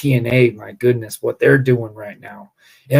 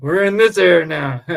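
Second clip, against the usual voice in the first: emphatic repeat; 6 semitones higher, 6 dB louder than usual. Retold or imitated. Repeats his reply for laughs.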